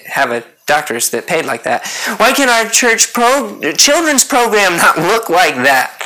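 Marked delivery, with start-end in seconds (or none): 2.2-6.0 slow, higher-pitched mock whine of envy; acted churchgoer griping in irritation; irritated envy. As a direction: drag out the jealous complaint, pitched up. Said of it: "We can get irritated when another church".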